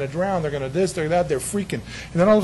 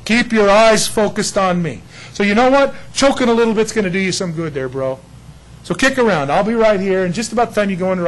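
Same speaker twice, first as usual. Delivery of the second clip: louder, higher playful ribbing; teasing Jesus; 4 semitones higher, 6 dB louder than usual. Retold or imitated. Jesus teasing Peter sinking, loud and playful. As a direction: rib him like a big brother. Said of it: "And Jesus just goes".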